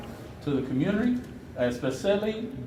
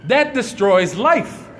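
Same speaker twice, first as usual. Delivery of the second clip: impassioned denunciation; 6 semitones higher than usual. Real, not acted. Activist condemns officials over poisoned water at press conference, impassioned outrage. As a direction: denounce them in a high, raised voice, pausing for weight.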